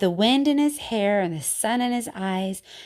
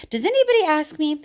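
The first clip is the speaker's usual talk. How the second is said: higher, faster exasperated complaint; 7 semitones higher, 4 dB louder, faster than usual. Acted. Teacher reading poem to kids, exasperated. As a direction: let the frustration spill out.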